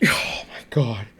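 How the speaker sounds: whispered, sighing disbelief; exasperated sigh. Real, not acted. Reviewer worn down by an unbearable album, exasperated whisper. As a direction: let it out as a spent, voiceless sigh.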